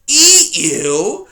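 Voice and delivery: put-on loud, grumbling old porcupine; loud cranky old porcupine; grumpy old porcupine. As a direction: snap at the intruder like a cranky old coot. Erith voiced in story.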